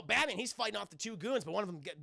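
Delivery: ordinary talk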